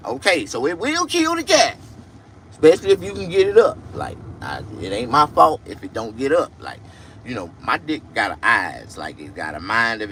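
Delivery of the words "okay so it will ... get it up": high, loud, rushed boast; loud brag. Acted. Bragging to the camera, loud excited boasting. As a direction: crow it out fast like a proud show-off.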